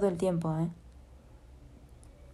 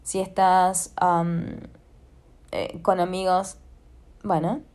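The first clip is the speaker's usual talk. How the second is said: normal talking voice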